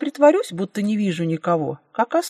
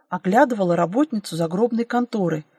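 plain reading voice

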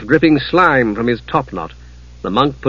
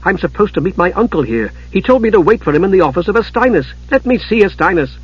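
higher-pitched urgent pleading; growing desperation; 6 semitones higher than usual. A narrator's reading. Palin asking for his uncle, growing desperate. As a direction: plead your case more and more desperately. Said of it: "Palin was growing desperate".

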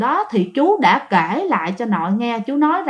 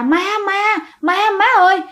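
a pitched-up mock yell of ghost, ghost; yelling in fright; 8 semitones higher than usual. Uncle running from the ghost, yelling for his mother in a raised, higher mock yell. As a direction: cry out for mom, higher, like a scared man running. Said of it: "vừa chạy chú vừa la".

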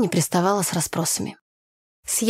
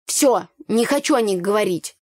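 ordinary delivery

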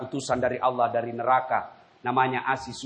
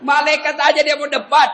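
excited remark; 13 semitones higher, 7 dB louder than usual. Real, not acted. Preacher mocks the dead man.

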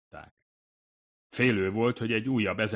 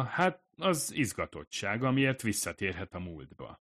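ordinary delivery

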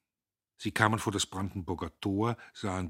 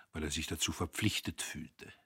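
ordinary delivery

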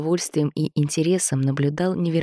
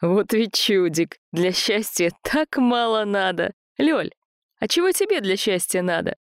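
Andrey voice; 5 semitones higher than usual. Acted. Andrey voiced, amused then asking.

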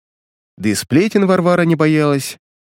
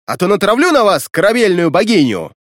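громкая выше угроза; пригрозить, повысив голос; угрожающий окрик; 7 semitones higher than usual. A narrator's reading. Ведьма угрожает непослушным русалкам. (громкая угроза)

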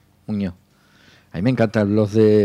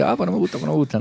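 high whiny complaint; grumpy caller; 6 semitones higher, 3 dB quieter, much faster than usual. Retold or imitated. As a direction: whine like an annoyed listener. Host imitating complaining listeners.

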